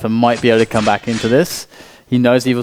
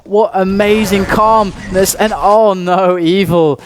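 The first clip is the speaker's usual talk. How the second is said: excited play-by-play climbing much higher; esports caster; 9 semitones higher than usual. Real, not acted. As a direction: build the hype as the shot lands. Caster calling a clutch final frag.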